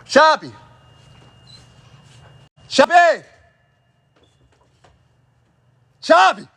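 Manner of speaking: loud, high call across the yard; calling the puppy off the porch. Real, not acted. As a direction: shout the puppy's name.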